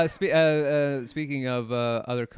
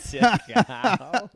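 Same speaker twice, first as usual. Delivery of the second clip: laughing echo; 8 semitones higher, 6 dB louder than usual. Real, not acted. Joking about Aussies.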